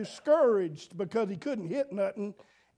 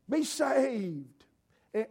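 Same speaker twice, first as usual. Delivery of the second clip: ordinary talk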